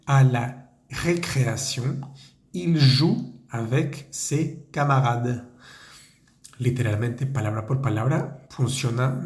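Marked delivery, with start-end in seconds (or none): 0.0-5.4 slow, careful French sentence for learners; slow model reading. Shown as a demonstration. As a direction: spell it out slowly for students. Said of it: "nuestra última frase".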